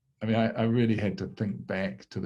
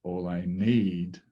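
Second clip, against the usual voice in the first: slow down and land on "need"; stress on NEED; slower than usual. Shown as a demonstration. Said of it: "shifting the emphasis from all to I to need".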